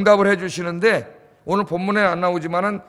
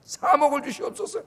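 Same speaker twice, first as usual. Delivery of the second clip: higher, pleading prayer with a rising end; mock fervent prayer; 8 semitones higher, 4 dB quieter than usual. Preacher acts out a church praying for money, earnest and pleading. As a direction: plead earnestly, ending upward.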